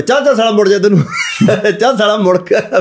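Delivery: high-pitched mock scolding, amused; imitating villager's scolding. Villager scolds boy, told laughing. (हँसते हुए गाँव वाले की नकली डाँट)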